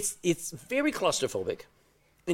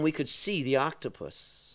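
normal talking voice

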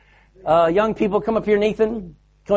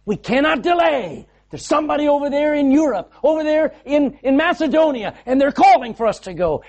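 high, louder urgent rallying cry; Paul's urgent call; 10 semitones higher, 3 dB louder than usual. Retold or imitated. Paul rallying fellow Christians, urgent and high. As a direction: urge them on, no time to lose. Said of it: "He says".

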